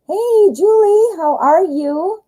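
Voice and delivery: high, drawn-out greeting call; radosne powitanie. Real, not acted. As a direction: greet a viewer in a high, slow lilt. Greeting a viewer by name.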